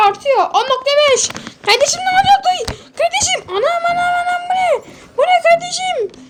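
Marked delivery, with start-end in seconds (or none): 1.6-6.2 high-pitched hammed-up panic, pitch way up; mock panic. Gamer panicking at the growing quake, voice pitched high. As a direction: squeal it like the ground is giving way.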